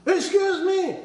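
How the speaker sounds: high, indignant "Excuse me!". Acted. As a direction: pitch it way up, as if protesting. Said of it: "don't raise your voice".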